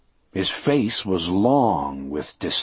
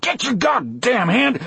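high, startled protest; shocked high-pitched order to back off; alarmed cry. A narrator's reading. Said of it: "I cried".

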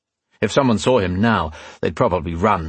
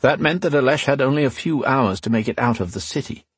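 ordinary delivery, nothing marked

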